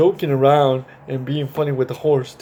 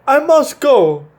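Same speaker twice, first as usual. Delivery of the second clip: slow and much higher, louder horse voice; high, slow horse says goodbye; the horse speaking; 10 semitones higher, 5 dB louder, slower than usual. Acted. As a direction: stretch it out high like a gentle storybook horse. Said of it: "said the horse".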